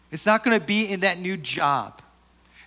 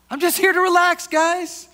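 much higher, louder, hammed-up "I'm just here to relax"; mock golfer; 13 semitones higher, 8 dB louder than usual. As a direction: parody the stressed golfer's claim. Mocking repeat of golfer's line.

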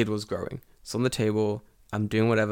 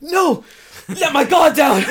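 high, loud hammed-up cry of despair; mock anguished yell; 7 dB louder, slower than usual. Teacher hams up being caught off guard by a colleague. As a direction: wail it like a captured hero. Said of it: "I'm like".

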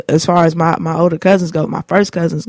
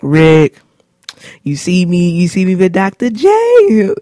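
teasing stepdad; 2 semitones higher, 5 dB louder, slower than usual. Retold or imitated. Stepdad bragging to Rick over a video game, hyped and louder. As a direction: show off like a stepdad beating you at the game. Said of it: "he'd be like".

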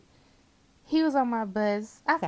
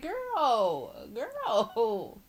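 put-on high drawn-out girl, girl, dropping; disbelieving girl; 5 semitones higher than usual. Driver reacting to her coworker, drawn-out high girl. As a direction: stretch each girl out in disbelief. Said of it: "I'm like".

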